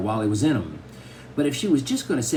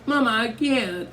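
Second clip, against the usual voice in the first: put-on high-pitched tearful little girl; 11 semitones higher than usual. A narrator's reading. Daughter refuses her mother's order, tearfully. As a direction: plead high, tears coming. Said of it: "The tears really started coming".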